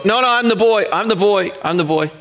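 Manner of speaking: a hint of a kid protesting I'm the boy; imitating little boy. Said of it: "I'll hear another voice go".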